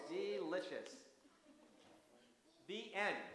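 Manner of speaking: put-on gentle storybook reading for kids; story narration. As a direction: read it like a bedtime story. Reads story to children.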